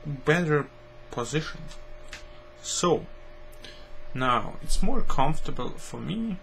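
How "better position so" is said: ordinary talk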